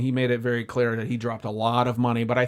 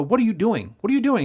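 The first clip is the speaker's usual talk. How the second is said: rising higher disbelieving repeat; alarmed; 7 semitones higher than usual. Retold or imitated. Driver confronting client, rising alarmed repeat. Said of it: "And I go".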